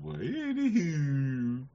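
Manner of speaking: high, slow, laughing Anywho; caller's Anywho. Retold or imitated. Host echoing the caller's catchphrase, high and slow. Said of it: "He used to talk like that".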